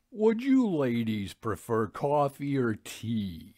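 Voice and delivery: slow, sugary host voice; cloying. A narrator's reading. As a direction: smile too sweetly through the offer. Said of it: "with a cloying smile".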